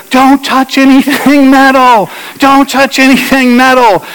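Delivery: high, urgent shout over the storm; retold shout. Pastor recalling shouting to his wife in the storm, high urgent yell. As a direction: yell a warning above wind and rain. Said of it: "we're shouting to each other".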